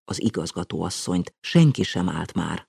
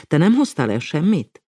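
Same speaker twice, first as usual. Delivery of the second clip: questioning; 8 semitones higher, 5 dB louder than usual. A narrator's reading. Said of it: "Számonkérés, vagy inkább érdeklődés".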